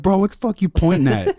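high-pitched irked opponent; mock indignation. Retelling an opponent's annoyed trash talk.

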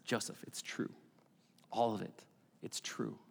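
soft, low, near-whispered reassurance; hushed reassurance. Acted. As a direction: speak gently to a sleeper in a dream. God speaking to sleeping Joseph.

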